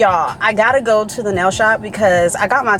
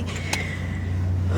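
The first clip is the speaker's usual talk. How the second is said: swooning sigh; 11 dB quieter than usual. Real, not acted.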